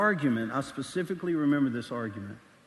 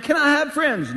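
high, loud, anxious question; hammed-up hopeful plea; pleading student; 10 semitones higher, 8 dB louder than usual. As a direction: ask like a worried pupil with a hand up. Student raises hand to ask.

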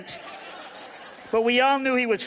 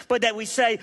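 ordinary talk

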